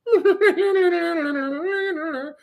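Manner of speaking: high sustained vocal guitar lick; imitated guitar noodling. Mocking a guitar salesman showing off, imitated arpeggio. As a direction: mimic a showy arpeggio with a high rippling noise.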